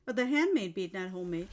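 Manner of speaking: ordinary talk, nothing marked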